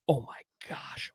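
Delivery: soft, hushed, amazed whisper; awed whisper. Retold or imitated. Hunter seeing the buck close below his stand, awed whisper. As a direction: breathe it out in awe.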